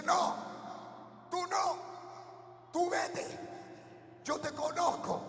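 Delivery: a hint of a high demon voice, pushing away; the demon warning him off, lightly voiced. Possessed girl's demon telling pastor to leave, mild. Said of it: "y me dice".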